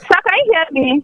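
calling out on phone. Real, not acted. Checking the line on a call.

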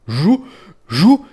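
high, loud beetle buzz. A narrator's reading. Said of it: "заджичав голосно Жук". (гучне жужжання жука)

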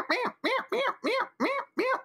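very high squeaky yap-yap; mock yapping. Host imitates the neighbor's little dog barking, very high. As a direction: squeak it out like a tiny frantic dog. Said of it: "the dog's just".

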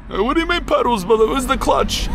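high mock bro voice, puzzled by the paddles; teasing impression of a confused Evo fan; mocking Evo head. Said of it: "the Evo heads don't like 'em".

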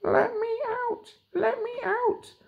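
very high, slow tiny voice; gingerbread man voice. Acted. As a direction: squeak from inside the oven. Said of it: "noise coming out of the oven".

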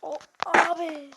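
sharp startled cry; startled shout. Real, not acted. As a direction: react to nearly falling. Near fall reaction.

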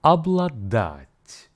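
Обладать slow, ab-la-DAT; Обладать drawn out for practice; slow repeat model. Shown as a demonstration. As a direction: give Обладать slowly to repeat. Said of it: "listen again and repeat".

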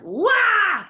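loud high wake-up cry; big loud noise. Shown as a demonstration. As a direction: blast it out to startle. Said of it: "make a big loud noise".

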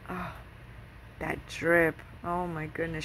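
usual speaking voice

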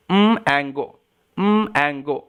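low-pitched split of mango after its first sound. Shown as a demonstration. Teacher repeating for learners, low sounded-out mango. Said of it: "um, সাউন্ডটাই".

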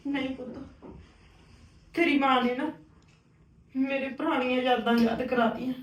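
soft, halting confession after crying; subdued sorrow. Acted. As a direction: confide the old hurt quietly, with long pauses. Upset aunt confides after crying.

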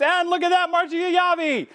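bright, high burst of delight at the donors; light up at the donation; excited. Real, not acted. Fundraiser host cheering two big donors, excited.

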